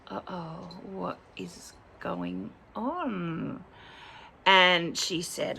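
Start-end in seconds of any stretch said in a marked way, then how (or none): 0.1-3.6 wary inner thought. Retold or imitated. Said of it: "I'm thinking".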